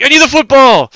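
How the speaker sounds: rowdy fan. Retold or imitated. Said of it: "and he's like".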